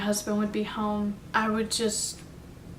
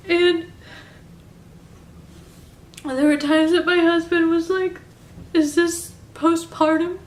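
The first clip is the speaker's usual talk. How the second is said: higher, louder tearful voice breaking; crying; 7 semitones higher, 5 dB louder than usual. Real, not acted. Mother recalling postpartum to viewers, crying through it. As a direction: speak through real tears, overwhelmed. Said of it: "I don't wanna cry".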